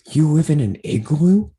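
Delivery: loud, incredulous question after a gasp; mock astonishment. Girl from Michigan learning they're Canadian, loudly astonished. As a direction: imitate a naive girl amazed by Canadians.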